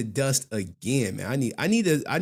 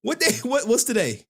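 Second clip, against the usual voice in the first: higher, puzzled viewer; a baffled viewer's raised voice; high mock confusion; 11 semitones higher, 4 dB louder than usual. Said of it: "You like".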